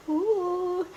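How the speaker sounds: high cheer of delight; excited woo. Real, not acted. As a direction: whoop with joy. Cheering on the ride.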